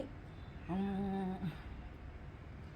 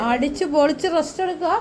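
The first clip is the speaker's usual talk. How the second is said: teasing call; 3 semitones higher, 10 dB louder than usual. Real, not acted. Teasing husband resting, calling loudly.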